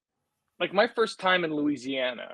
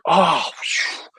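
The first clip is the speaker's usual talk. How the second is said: lightly voiced French cabbie, higher, with a sigh of relief; mock relief from the Nice taxi driver, pitched up; relieved French cabbie; 8 semitones higher, 4 dB louder, slower than usual. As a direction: play the cabbie relieved he's not talking to a Quebecer. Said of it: "He's like".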